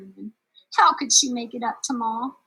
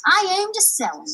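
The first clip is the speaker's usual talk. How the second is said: put-on higher, eager young girl; bright-voiced cowgirl, raised pitch; girl's voice; 11 semitones higher, 6 dB louder than usual. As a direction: lift it up like a spunky kid. Juanetta voiced.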